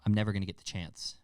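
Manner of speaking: usual speaking voice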